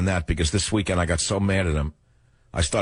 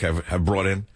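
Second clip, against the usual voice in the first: ordinary delivery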